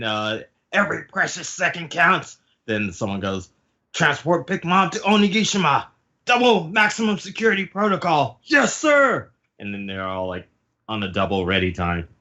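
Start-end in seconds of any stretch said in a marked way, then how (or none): 0.7-2.4 high, hammed-up urgency; urgent order. Hurrying the crew to bind Big Mom, high urgent voice. 3.9-9.3 high, emphatic mock-military order, higher reply; urgent command. Order to transport Big Mom to Onigashima, high urgent shouts. Officer orders, crew answers.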